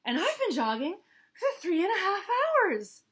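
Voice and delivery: high, loud proud declaration; Hippo boast. Acted. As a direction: proclaim it.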